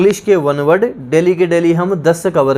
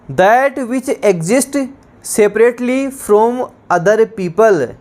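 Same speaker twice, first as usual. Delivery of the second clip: very slow, higher dictation of the definition; 3 semitones higher, much slower than usual. Shown as a demonstration. Teacher dictating to students, very slow and higher. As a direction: spell the phrase out word by word. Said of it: "dictating definition".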